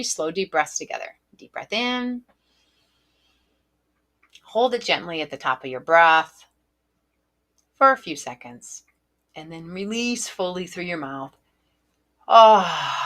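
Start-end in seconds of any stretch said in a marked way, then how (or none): 1.4-11.4 soothing breathwork instructor; guided breathing. Acted. Leads a breathing exercise. 12.3-13.0 demo sigh. Shown as a demonstration. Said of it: "even into a nice sigh".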